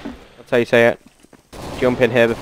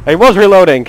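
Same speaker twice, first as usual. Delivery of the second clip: loud, higher complaint with a laugh around it; laughing protest; 5 semitones higher, 5 dB louder, faster than usual. Real, not acted. Gamer killed while reloading, loud amused protest. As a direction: protest loudly between laughs.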